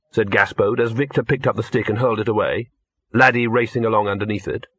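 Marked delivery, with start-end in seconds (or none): none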